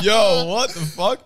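loud, high, stretched-out disbelief; shocked outburst. Retold or imitated. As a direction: blurt it out, stunned and drawn out. Retelling his shocked reaction.

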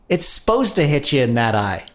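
normal talking voice

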